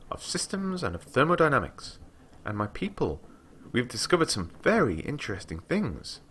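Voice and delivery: a young scholar boasting to a traveller, lightly voiced; lightly voiced eager girl. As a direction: read her up high and keen, lightly. Girl's voice again.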